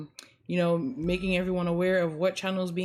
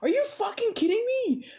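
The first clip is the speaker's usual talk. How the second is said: exasperated yell; 14 semitones higher than usual. Retold or imitated. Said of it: "the person's like".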